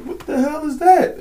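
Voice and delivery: high, baffled squawk; hammed-up baffled high voice; mock surprise. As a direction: gawk at the new sign. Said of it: "It's like".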